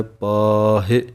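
ਪਾਹੇ with a drawn-out long aa and a rising end. Shown as a demonstration. Teacher stretches ਪਾਹੇ for students, slow and rising. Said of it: "ਪੱਪੇ ਦੇ ਕੰਨੇ ਨੂੰ ਲੰਮਾ ਬੋਲਣਾ ਹੈ".

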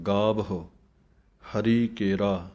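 slow ਗਾਵ ਹੋ ਹਰੀ ਕੇਰਾ, stopping after ਕੇਰਾ; pause after ਕੇਰਾ. Shown as a demonstration. Teacher showing the rest after ਹਰੀ ਕੇਰਾ. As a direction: rest on ਕੇਰਾ before going on. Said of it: "ਇੱਥੇ ਵਿਸਰਾਮ ਹੈ".

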